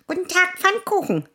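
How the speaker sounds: sehr hohes Mäusepiepsen; Maus. Acted. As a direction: quiekend wie eine Maus. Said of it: "quiekte die Maus". (quiekende Maus)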